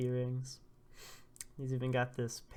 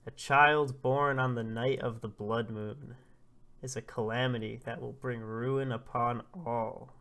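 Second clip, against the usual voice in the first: put-on ominous old prophet; 6 dB louder than usual. Old man warning a father-to-be about the blood moon, ominous proclamation. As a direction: pronounce doom with measured, weighty emphasis.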